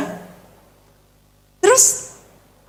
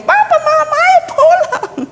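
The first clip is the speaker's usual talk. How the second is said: very high-pitched mock child calling papa and mama; put-on piercing kid voice; imitated child. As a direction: mimic a child's squealing plea to go home. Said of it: "persis sekali ngomongnya".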